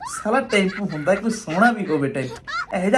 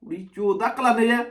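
usual speaking voice

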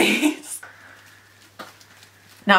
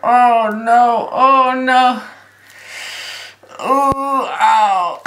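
pained cry; much slower than usual. Real, not acted. Peeling latex off face.

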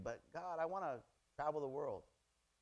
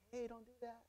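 soft warning; 7 semitones higher, 10 dB quieter than usual. Shown as a demonstration. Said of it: "Do you say".

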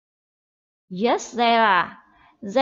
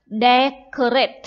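decorate, stress hit on DEC, slowly drawn out; stress on first syllable; 6 dB louder, much slower than usual. Shown as a demonstration. Teacher modelling the word for pupils, stress on DEC. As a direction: say decorate slow with DEC loud. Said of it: "trọng âm ở âm tiết đầu tiên".

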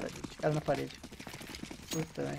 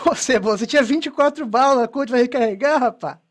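loud, raised-pitch teasing after a laugh; loud, amused scolding; incredulous ribbing; 4 semitones higher, 7 dB louder than usual. Real, not acted. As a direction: call out a teammate's silly mistake, half laughing. Laughing, ribs teammate for reloading.

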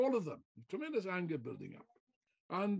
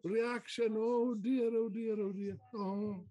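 higher, slow, pearl-clutching whine; mock hand-wringing lefty; 4 semitones higher, slower than usual. Mocking fake lefts wringing their hands over reaction. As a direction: send up the fretting fake left. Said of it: "the fake lefts going around clutching".

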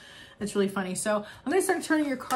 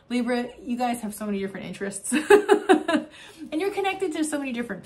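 laughing; 3 semitones higher, 4 dB louder than usual. Real, not acted. Laughing at guides' examples.

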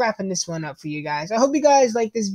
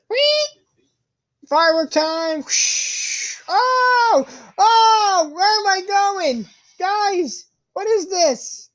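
excited screaming; 11 semitones higher, much slower than usual. Real, not acted. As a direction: scream with delight as you fly. Launched up by the firework.

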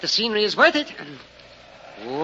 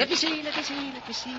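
quick, higher eager repeats, trailing off; 9 semitones higher, 6 dB quieter, faster than usual. Acted. Botanist hurries to inspect Rip's find, eager. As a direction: lean in, eager to look.

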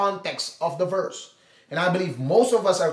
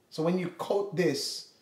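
ordinary talk

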